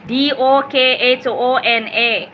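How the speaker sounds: slow, letter by letter, D O K H O N A; spelling it out. Shown as a demonstration. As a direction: spell D O के H O N A clearly for viewers. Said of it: "दोकोना".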